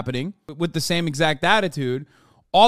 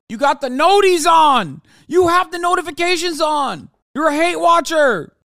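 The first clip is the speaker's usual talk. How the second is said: yelling, much higher and louder; real shout; 11 semitones higher, 8 dB louder than usual. Real, not acted. Streamer yelling at the obsessed pundit, loud and high. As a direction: blast it at him, outraged.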